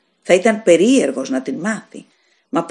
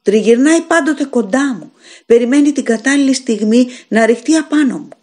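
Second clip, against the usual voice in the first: higher, louder, frightened pleading; terrified; 5 semitones higher, 4 dB louder than usual. Acted. Dying man tells the landlord the demon lurks, terrified. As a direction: warn of something lurking, in fear.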